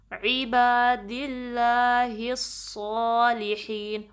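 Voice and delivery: very slow, drawn-out recitation of the greeting. Shown as a demonstration. Teacher reciting the phrase for students to repeat. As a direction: stretch each vowel in a measured chant. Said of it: "صاد heavy ہے".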